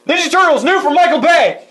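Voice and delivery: high-pitched mock trailer plug; mock movie announcement. Co-host spoofing a movie plug, high mock announcer.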